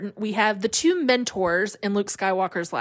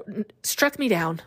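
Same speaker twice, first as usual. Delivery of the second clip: ordinary delivery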